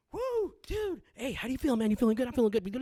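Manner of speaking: high, rattled-off caffeine babble; hyped-up jumpy. Retold or imitated. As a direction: imitate being buzzed and bouncing. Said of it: "like, jumping".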